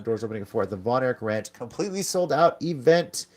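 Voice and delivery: upbeat promo announcer voice; announcer read. Acted. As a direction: plug the event like an announcer.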